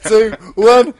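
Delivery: excited countdown. Real, not acted. Toast countdown.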